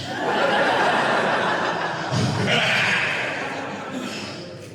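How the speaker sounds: long high laugh falling away; real laugh. Real, not acted. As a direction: laugh hard at the punchline. Laughing at own story.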